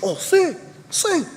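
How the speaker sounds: put-on eager kid voice. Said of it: "He goes".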